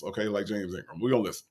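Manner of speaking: ordinary delivery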